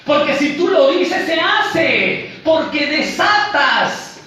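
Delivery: high, loud, drawn-out mock proclamation; mock pulpit shouting, stretched endings; mimicked declaration preacher. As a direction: imitate a hyped-up preacher commanding declarations. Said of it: "hay muchas pseudoiglesias donde dicen".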